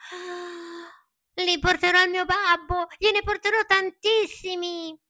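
breathy sigh then very high, loud joy; sigh, then burst with hope; sighing dreamily. Acted. Pinocchio dreaming of his father's riches, sighing then high. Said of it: "sospirando disse".

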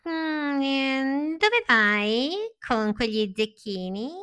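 high, slow crafty fox voice; sly fox. Acted. Fox sizing up Pinocchio's coins, high and sly. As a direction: purr it like a scheming fox. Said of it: "con aria furba".

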